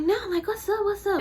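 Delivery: high-pitched mock challenge; picking a fight. Customer refusing the manager, high mock challenge. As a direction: imitate a customer squaring up. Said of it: "trying to fight me".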